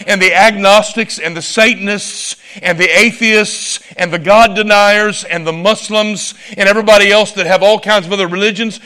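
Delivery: ordinary delivery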